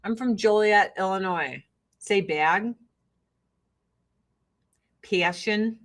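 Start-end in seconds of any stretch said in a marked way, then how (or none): 2.2-5.8 "bag" and "Passion" with a raised Midwestern a; Midwestern short a. Shown as a demonstration. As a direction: say "Passion" like "pay-ashun". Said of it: "what I got in trouble for".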